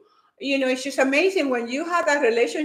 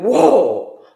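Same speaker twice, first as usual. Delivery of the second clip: loud whoa; 9 dB louder than usual. Retold or imitated. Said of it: "I went".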